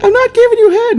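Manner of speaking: very high, loud, panicked yelp; panicked squeal. Retold or imitated. Dwayne, chased through the house, squealing high in panic. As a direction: shriek it like a kid running for his life. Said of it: "and Dwayne's like".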